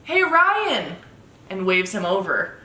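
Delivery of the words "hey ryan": high, loud call across a distance; retold yell. Girlfriend imitating him yelling over at the skate park, calling out. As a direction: call out to get someone's attention. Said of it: "and he yells".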